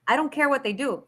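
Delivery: usual speaking voice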